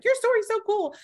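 high, bright mock nurse gush. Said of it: "to tell me, like".

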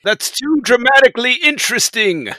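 high sarcastic mock voice; squeaky, loud imitation of a producer; mock Roddenberry. Mocking Roddenberry's objection.

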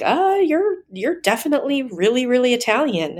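high-pitched imitation of an insistent husband; emphatic retold correction. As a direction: pitch it up, imitating a husband setting someone straight. Husband's reply in retold quarrel.

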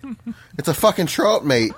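insistent Irish correction, TROUT for throat; indignant Irish-accented correction. Shown as a demonstration. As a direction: stress TROUT in an Irish brogue. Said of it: "listening to this Irish prick".

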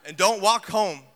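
a mother barking instructions; mild mock mom command; mom's order. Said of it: "My mom's like".

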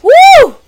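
loud high whoop; cheering woo. Real, not acted. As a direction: celebrate with a big woo. Cheering after getting name right.

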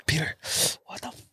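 usual speaking voice

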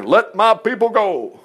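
slow, lightly declaimed command; a hint of a prophet's decree; acted solemn demand. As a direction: make a drawn-out proclamation, lightly acted. Voicing Aaron's demand to Pharaoh.

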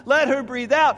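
high, loud retold plea; crying out to God. Father retelling his prayer for his baby, crying out. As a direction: throw the plea out with full urgency. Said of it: "my heart cried out to God".

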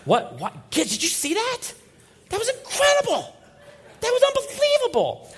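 very high, loud, giddy outburst; fired-up exclamation. Retold or imitated. Preacher retelling telling his kids about it, very high and thrilled. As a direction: bubble over with adrenaline. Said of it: "I'm pretty fired up".

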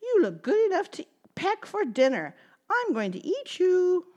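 high clucky hen voice; chicken voice. Acted. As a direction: speak like a hungry hen. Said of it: "said the chicken".

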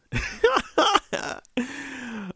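loud high-pitched laugh. Real, not acted. Reviewer delighted by the film's ending, laughing.

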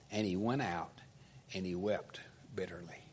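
low, hushed, sorrowful reading; quiet, heavy-hearted narration; subdued sorrow. A narrator's reading. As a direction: let the voice sink softly in grief. Preacher reads Peter's bitter weeping.

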